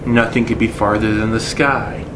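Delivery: usual speaking voice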